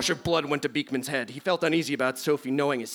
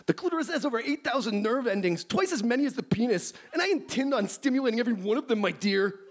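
high, hammy aroused professor; overheated nerd, played for laughs; lusty scientist voice; 9 semitones higher than usual. Plays Beekman.

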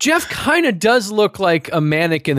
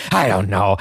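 usual speaking voice

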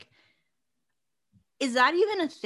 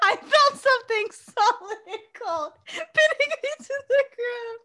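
high, loud, laughing melodrama reading; cracking up while reading it dramatically; laughing mock reading; 12 semitones higher, 5 dB louder than usual. Friend hams up a book line while laughing.

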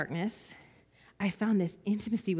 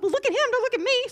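excited urging; 6 dB louder, faster than usual. Retold or imitated. Counselor urging the girl.

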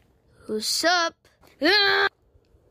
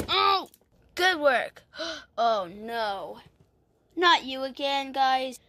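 ordinary talk

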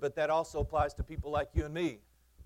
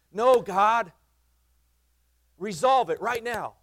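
loud, higher, demanding God act now; impatient prayer; 8 semitones higher, 12 dB louder, slower than usual. Retold or imitated. Preacher mimics his own impatient prayer, loud demand. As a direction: insist at God, loud and urgent. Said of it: "I pray that way sometimes".

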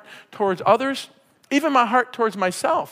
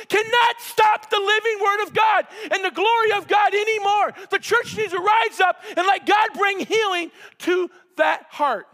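excited yelling; 8 semitones higher than usual. Real, not acted. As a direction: shout it out with joy to the church. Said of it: "I'm yelling with excitement".

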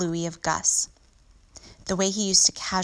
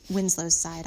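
ordinary delivery, nothing marked

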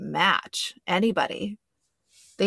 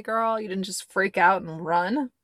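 normal talking voice

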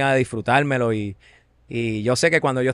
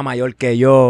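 slowed, louder, savoring the song name; drawn-out excited title; 8 dB louder, much slower than usual. Real, not acted. Recalling an old hit for the host, stretched and louder. Listing old reggaeton hits.

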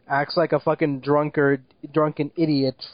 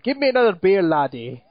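fake Irish accent; 2 semitones higher than usual. Mocking actor's fake Irish accent, hammy drunk voice. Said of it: "his Irish accent is so fucking fake".

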